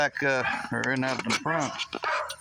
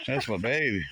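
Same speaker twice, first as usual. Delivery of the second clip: normal talking voice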